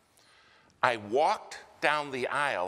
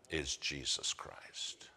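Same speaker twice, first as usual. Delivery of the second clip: slow hushed whisper; 7 semitones lower, 8 dB quieter, slower than usual. Real, not acted. Preacher whispers the only hope to the congregation. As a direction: drop to a whisper for the name.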